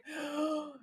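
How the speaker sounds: a quick, soft gasp of mock shock; a gasp at Dean watching the stripper. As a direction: catch your breath in scandalised surprise. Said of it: "And I was like".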